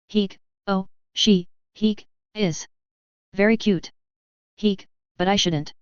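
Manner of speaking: talking between hiccups; hiccuping. Acted. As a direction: hiccup through the words. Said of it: "T Sunk Al just hiccuped".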